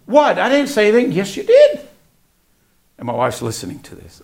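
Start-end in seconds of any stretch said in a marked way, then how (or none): 0.0-1.1 high-pitched protest of innocence; retold indignant little denial; defensive kid. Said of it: "The little attitude, the, the way you just spoke". 1.1-1.8 very high, loud comeback; mock parental contradiction; retold squeaky insistence; insistent retort. Father retorts to child's denial.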